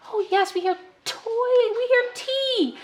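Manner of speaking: high, slowed praise; child-directed praise. Shown as a demonstration. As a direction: cheer the child's answer. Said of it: "have them put a T on a toy".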